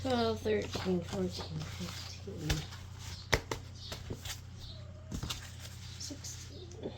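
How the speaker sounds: quiet counting, trailing off; counting under breath. Real, not acted. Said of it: "Hold on, I'm counting".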